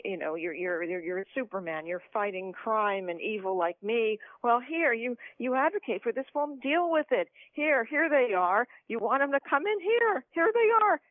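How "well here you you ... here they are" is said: loud, high, mocking "here they are"; sarcastic shout. Taunting the privileged progressives, shouting mockingly. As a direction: shove it at them, loud and taunting. Mock handing migrants to progressives.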